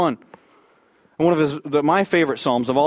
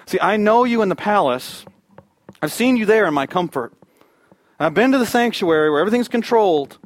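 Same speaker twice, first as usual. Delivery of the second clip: raised, earnest address to God; impassioned preaching; 6 semitones higher, 4 dB louder than usual. Acted. David in the desert speaks to God, impassioned. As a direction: lift the voice high as if calling to God. Voices David's prayer with force.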